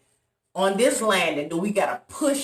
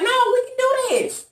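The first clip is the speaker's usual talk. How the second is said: high, eager insistence; 3 dB louder than usual. Retold or imitated. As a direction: urge him on brightly.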